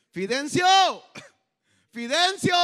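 loud searching shout, high and strained; shouting for a lost man; calling out a name. Retold or imitated. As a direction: yell the name across the hills. Search party calling for missing man.

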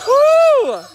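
high, loud joyful whoop; excited whoop. Real, not acted. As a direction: let out a happy cheer. Excited cheer at noisy animals.

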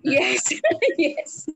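yes said through laughter; laughing. Real, not acted. Trainer agrees with learner about training, laughing.

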